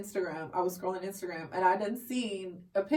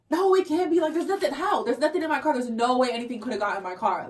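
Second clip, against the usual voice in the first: louder, higher imitation of a friend in denial; frantic denial; 3 semitones higher, 4 dB louder than usual. Friend denying anything is in her car, frantic denial. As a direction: insist it can't be, acting her disbelief.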